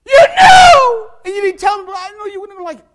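high, pained cry of a lost friend; anguished shout from hell. Retold or imitated. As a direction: scream the blame up from hell, voice cracking. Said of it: "they're gonna go to hell and say".

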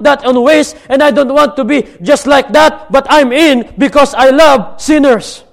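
fervent, loud "I'm in" cry; eager volunteer. Pretend. Imagined church member answers the pastor, fervently eager. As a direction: shout your yes like the first to sign up. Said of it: "You will be the first one to say".

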